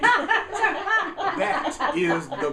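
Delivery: hammed-up stage recital, the last line drawn out slow; mock Shakespeare. Parodying Hamlet for friends, slow mock-grand recital. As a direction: play a grand tragic actor, lightly. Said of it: "just like Shakespeare would say".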